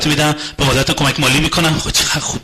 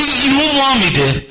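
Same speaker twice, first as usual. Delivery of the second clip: slow collapse; 8 semitones higher, 4 dB louder, much slower than usual. Acted. Spouse suddenly gives up.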